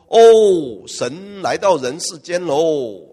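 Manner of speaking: loud awed oh, then slow drawn-out announcement; awed crowd. Acted. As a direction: hail the gods in wonder. Crowd hails gods arriving.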